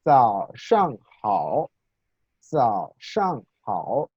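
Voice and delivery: "zao shang hao" spelled out very slowly, syllable by syllable; "zao shang hao" at a crawl, with clear Mandarin tones; slow Mandarin demo. Shown as a demonstration. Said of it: "Dice, "Buenos días".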